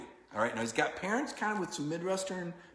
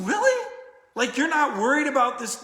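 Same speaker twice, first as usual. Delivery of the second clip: higher, louder incredulous question; can't believe he's this calm; retold disbelief; 9 semitones higher, 8 dB louder than usual. Reporter asking the calm quarterback, loudly incredulous. Speaker retells his disbelief.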